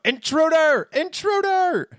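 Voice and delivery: very high, loud cry of alarm; shrieked high alarm, word drawn out; alarm shout. Acted. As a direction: shout the warning to the whole house. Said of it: "and I will shout".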